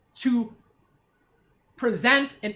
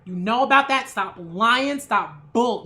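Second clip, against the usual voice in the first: usual speaking voice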